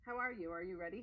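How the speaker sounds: soft, distant phone voice; quiet phone line voice; husband on the phone. Retold or imitated. Husband Steve calling his wife before her talk, soft as on a phone. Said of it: "his serious voice".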